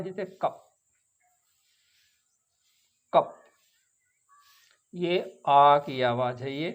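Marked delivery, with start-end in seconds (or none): 0.0-3.3 कप with a short open uh; short a sound. Shown as a demonstration. Teacher drilling the short uh vowel. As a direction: keep the uh short in कप. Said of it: "छोटा अ है".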